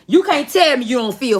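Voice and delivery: ordinary delivery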